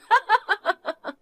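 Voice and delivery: high, gloating giggle; short high-pitched taunting laugh; mocking laugh. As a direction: laugh down at her rival. Niece mocking her aunt's loss.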